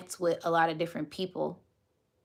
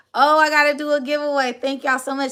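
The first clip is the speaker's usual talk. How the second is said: excited; 8 semitones higher, 9 dB louder, faster than usual. Real, not acted.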